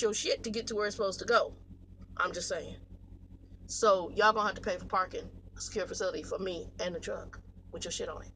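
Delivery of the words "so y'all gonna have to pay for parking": ordinary talk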